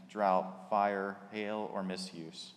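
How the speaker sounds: ordinary talk, nothing marked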